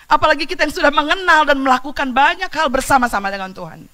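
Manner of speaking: loud, high proclamation of grace; preacher calling the congregation to repent; fervent preaching. Acted. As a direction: lift the whole room with it.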